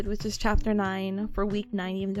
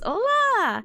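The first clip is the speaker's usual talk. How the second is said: higher, louder sing-song "Hola"; cheerful loud hello; 10 semitones higher, 5 dB louder than usual. Real, not acted. Greeting the chat again, louder and higher in pitch. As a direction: call out "Hola" brightly to the chat. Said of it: "greeting chat".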